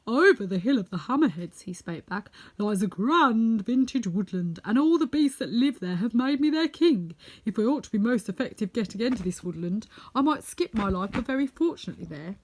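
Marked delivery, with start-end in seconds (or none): 0.1-1.6 loud, proud lion telling of his forest. Acted. 2.6-12.4 loud, proud lion telling of his forest. Acted.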